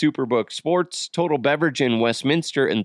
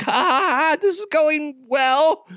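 hammed-up strained glee; nervous fake laugh; 12 semitones higher than usual. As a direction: squeal it with forced, panicky laughter. Retold nervous fake laugh to son.

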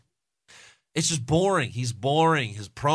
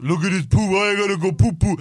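mocking promo voice; 7 semitones higher, 4 dB louder than usual.

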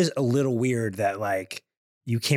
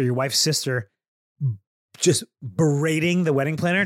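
normal talking voice

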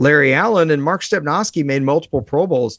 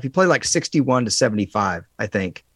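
usual speaking voice

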